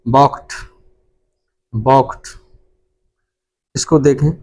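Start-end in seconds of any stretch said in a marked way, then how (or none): 0.1-2.3 balked said as "bawkt", ending in t; correct pronunciation. Shown as a demonstration. Teacher drilling the class on balked, ending t. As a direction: model balked with a clipped t at the end. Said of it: "इसको पढ़ा जाएगा".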